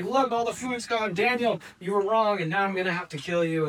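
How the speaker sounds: high-pitched excited announcement, easing down; triumphant exclamation. Acted. King tells Daniel the food's gone, excited triumph. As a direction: crow over a friend proven wrong.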